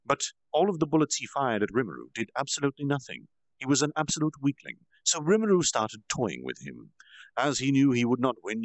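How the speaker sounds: ordinary delivery, nothing marked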